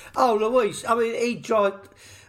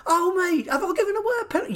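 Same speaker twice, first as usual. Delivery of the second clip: much higher, rushed fake innocence; mock innocent defender; 12 semitones higher, faster than usual. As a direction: squeak it out like a guilty player playing dumb. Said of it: "I was like".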